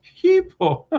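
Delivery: very high, loud mock-Greek call; mock Greek translator. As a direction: pipe the word out high and loud, playing at Greek. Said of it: "that's my old Greek translations".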